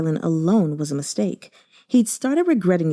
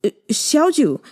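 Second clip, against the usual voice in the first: calling after him; 8 semitones higher than usual. Acted. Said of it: "Jiang Yexue cried after him".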